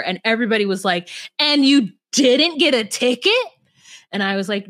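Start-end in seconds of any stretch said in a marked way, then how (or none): 1.4-3.3 mock-incredulous question, higher pitch. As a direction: imitate disbelieving coworkers. Acted incredulous coworkers' reaction.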